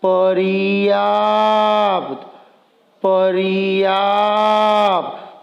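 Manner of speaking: each sound stretched very slowly; slow motion. Shown as a demonstration. Teacher slowing a word so pupils hear the parts. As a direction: drag the word out syllable by syllable. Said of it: "पर्याप्त में भी".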